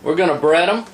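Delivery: usual speaking voice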